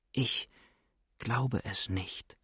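barely voiced, hushed and slow; a hushed, fearful near-whisper. A narrator's reading. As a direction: breathe it, afraid to be overheard. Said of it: "flüsterte Misses Everard, aber noch viel leiser als vorher".

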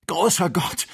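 hoher, lauter Ausruf des Schreckens; Schreckensschrei. A narrator's reading. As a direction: schrei entsetzt auf. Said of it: "schrie Kennedy". (erschrocken schreiend)

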